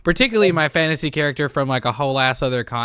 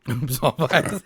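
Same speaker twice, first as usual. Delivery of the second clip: laughing. Real, not acted. Group joke repeating catchphrase.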